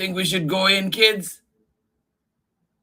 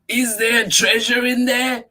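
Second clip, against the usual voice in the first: excited shout; 6 semitones higher, 7 dB louder than usual. Acted. Excited about treasure.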